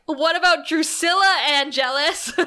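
high, loud mock-incredulous outburst; retold disbelief, played up for laughs; indignant exclamation. Retold outraged reaction to the line.

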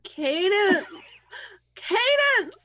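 loud, high, laughing call across the room. Real, not acted. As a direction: shout her name with a laugh. Calling out to a friend.

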